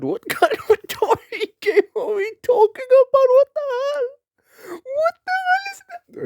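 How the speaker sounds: very high, squealing through real laughter; laughing to tears. Real, not acted. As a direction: lose it laughing, words squeaking out in disbelief. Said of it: "I'm smiling and I'm crying".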